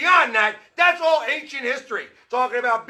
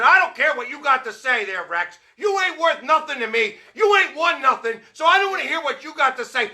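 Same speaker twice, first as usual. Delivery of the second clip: loud, heated rant at Rex; shouting down a critic; angry rant; 2 semitones higher, 4 dB louder than usual. Real, not acted. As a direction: tell him off, raising the voice. Ranting at Rex Ryan.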